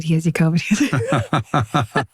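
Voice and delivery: put-on slow, drawn-out Jersey accent through a laugh; hammed-up Jersey handoff, laughing; mock Jersey accent. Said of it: "Good accent".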